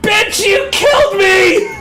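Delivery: furious ghost yelling at the one who shot him; angry ghost. Acted. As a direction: scream blame at the killer. Ghost accuses his killer.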